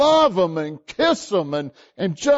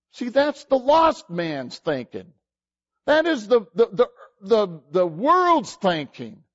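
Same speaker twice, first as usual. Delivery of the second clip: ordinary talk, nothing marked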